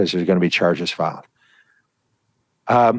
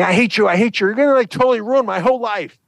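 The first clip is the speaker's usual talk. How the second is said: much higher, louder mock teen tantrum; screaming teen; 13 semitones higher, 4 dB louder, faster than usual. Daughter screams at mom over denied trip, high mock tantrum. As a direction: hurl it like a furious teen. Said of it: "started screaming at her".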